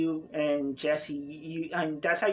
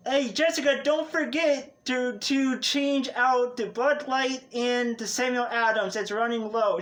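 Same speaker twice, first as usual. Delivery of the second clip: raised voice calling from the back room; 5 semitones higher than usual. Acted. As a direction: call it across the bar, pitched up. Bartender calls from back room.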